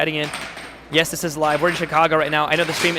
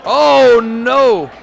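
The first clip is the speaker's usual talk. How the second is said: much louder, higher shocked Oh, no!; surprised cry; 6 semitones higher, 10 dB louder than usual. Real, not acted. Commentator reacting to a fumbled goal, shocked cry. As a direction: yelp at the fumble. Player fumbles and gets scored on.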